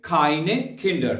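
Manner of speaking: keine Kinder drawn out slowly; slow demo. Shown as a demonstration. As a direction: stretch keine Kinder for learners. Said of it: "तो आप कहेंगे". (बहुत धीमे से keine Kinder)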